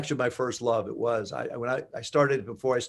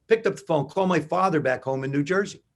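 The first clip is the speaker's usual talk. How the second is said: normal talking voice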